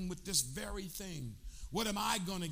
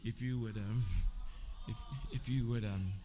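tender proposal; 10 semitones lower than usual. Real, not acted. Husband proposing again to wife in church, tender and low. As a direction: propose to her gently, voice dropped low.

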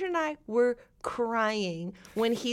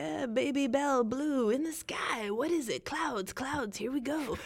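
lightly voiced rambling Walken, a touch quieter; mock Christopher Walken; 3 semitones higher, 5 dB quieter than usual. Mocking Walken's improvised film lines, half-hearted dreamy ramble. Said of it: "he'd be like".

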